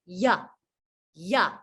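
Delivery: the y slides open into yeah; Y glide. Shown as a demonstration. Teacher modelling the Y glide for learners, sliding open. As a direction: let the mouth open up like a slide. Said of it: "Y says".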